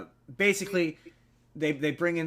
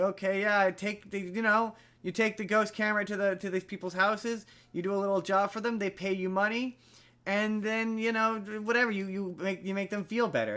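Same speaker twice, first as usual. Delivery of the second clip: higher, eager priest's pitch; imitating a pushy priest; 6 semitones higher than usual. Priest urging a skeptic to become a hero, higher and eager. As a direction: sell it like a hammed-up recruiter.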